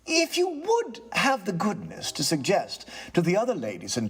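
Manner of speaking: polite, regaining his nerve; lightly voiced tenant. Tenant answers the ghost, calm and courteous.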